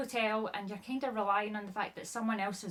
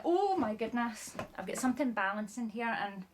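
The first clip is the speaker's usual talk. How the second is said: ordinary delivery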